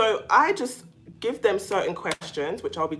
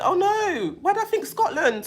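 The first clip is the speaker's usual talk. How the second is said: much higher, mortified surprise; dismayed realisation; 11 semitones higher than usual. Real, not acted. Streamer realising she mistook a viewer's country, high-pitched dismay. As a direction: squeal it like you just caught your own blunder.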